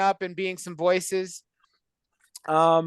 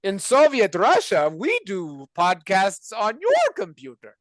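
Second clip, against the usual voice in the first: put-on slow, loud Russian accent; hammy Russian accent, louder; 2 semitones higher, 4 dB louder than usual.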